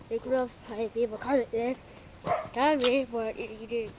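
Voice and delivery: normal talking voice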